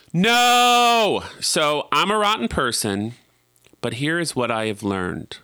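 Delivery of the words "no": high, loud no; emphatic shout. Real, not acted. Realizing she won't help, a loud emphatic no. Exclaiming at his own naive hope.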